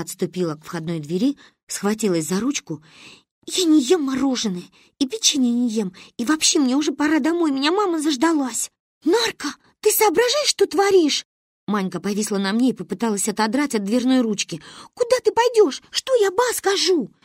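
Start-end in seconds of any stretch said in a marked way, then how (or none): 3.5-8.7 put-on higher-pitched girl refusing; stubborn child. 9.0-11.2 put-on very high, loud little girl; indignant child. Manya scolds her friend. 14.9-17.1 put-on very high-pitched girlish protest; exasperated child. Manya holds her friend back.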